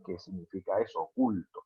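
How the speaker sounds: ordinary talk, nothing marked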